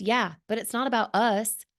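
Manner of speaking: ordinary talk, nothing marked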